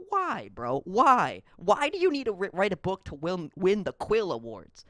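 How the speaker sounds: usual speaking voice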